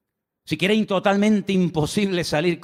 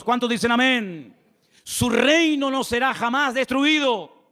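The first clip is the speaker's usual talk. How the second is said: higher, impassioned preacher reading scripture aloud; fervent preaching; 5 semitones higher than usual. Acted. Pastor proclaims Daniel's deliverance to the church, preaching fervently. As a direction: preach it to the congregation, rising with fervor.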